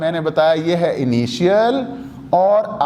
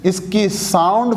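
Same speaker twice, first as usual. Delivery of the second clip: ordinary talk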